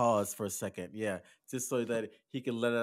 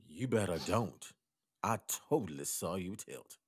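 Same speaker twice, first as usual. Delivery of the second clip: warning woman, repeated; 5 semitones lower, 4 dB quieter than usual. Acted.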